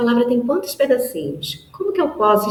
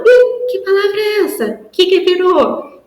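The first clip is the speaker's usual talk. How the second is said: much higher, excited as if amazed; mock surprise; 11 semitones higher than usual. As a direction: play amazed for the children. Teacher's mock surprise for child.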